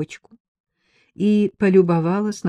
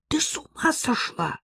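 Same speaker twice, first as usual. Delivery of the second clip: high-pitched outraged exclamation; indignant; 12 semitones higher, 3 dB quieter than usual. A narrator's reading. Ella objects to using the maid, indignant. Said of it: "возмутилась Элла".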